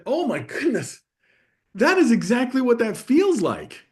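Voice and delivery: light up as if she'd nailed it; excited agreement. Retold or imitated. Dad answering his daughter on the bench, excited and loud. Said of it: "And I said".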